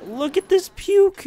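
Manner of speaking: high, slow, grossed-out look at this puke; disgusted squeal. Real, not acted. Gamer showing viewers a mess, grossed-out high squeal. Disgusted at messy park path.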